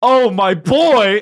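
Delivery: loud, high, drawn-out greeting; delighted recognition. Retold or imitated. Man suddenly recognizes yesterday's cigarette buyer, loudly delighted. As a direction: hail a long-lost pal, acted big.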